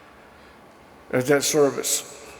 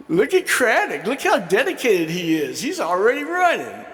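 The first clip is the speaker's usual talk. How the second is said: much higher, hammed-up coach bragging; mock-proud coach; 6 semitones higher than usual. Coach showing off the running player to teammates arriving at practice, high mock praise.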